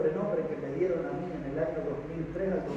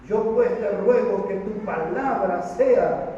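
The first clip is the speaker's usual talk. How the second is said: loud, slow, raised scripture reading; preaching at full force; 5 semitones higher, 6 dB louder, slower than usual. Acted. Preacher reading the messenger's words to the church, loud and slow. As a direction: proclaim it slowly to the congregation.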